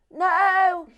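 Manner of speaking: high, loud shout up to upstairs; shouted call. Retold or imitated. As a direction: holler one drawn-out word to someone upstairs. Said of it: "person down here shouts".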